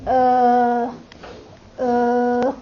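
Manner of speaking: UH held long, low and level; low hesitating tone. Shown as a demonstration. Teacher shows half third tone, low hesitating sound. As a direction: stretch UH like a hesitation, staying down. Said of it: "Just go hesitating".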